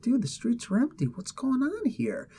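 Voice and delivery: high, hushed, puzzled voice; bewildered quote. Acted. Heroes finding Asgard's streets empty, high soft bewilderment. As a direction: squeak it softly in mock bewilderment.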